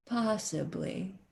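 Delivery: soft, slow, ominous "Possibly."; doctor dropping it quietly; grave hushed doctor. Retold or imitated. Doctor answering her worried question, soft and slow.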